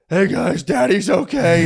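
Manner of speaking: a hint of a dad soothing his crying daughters; reassuring dad voice. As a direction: put on a bit of a brave dad voice. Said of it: "He just goes".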